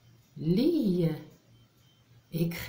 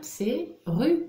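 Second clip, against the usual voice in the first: "ru" pitched high and pressed out; single out the syllable "ru" clearly for a child; teacher isolating "ru" sharply; syllable stressed; 7 semitones higher than usual. Shown as a demonstration. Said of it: "La syllabe".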